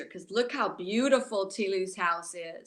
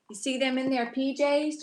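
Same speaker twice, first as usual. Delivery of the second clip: ordinary delivery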